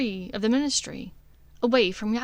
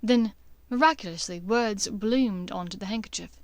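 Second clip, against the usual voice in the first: ordinary delivery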